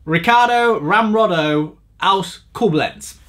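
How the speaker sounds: slow, hammed-up continental accent on "Ricardo Ramrodo aus Koblenz"; mock continental accent. Joking about his dating profile in a slow mock continental accent. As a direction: roll "Ricardo Ramrodo" like a showy continental playboy. Username redone as foreign version.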